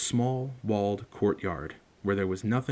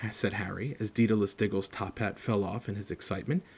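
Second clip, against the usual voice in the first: usual speaking voice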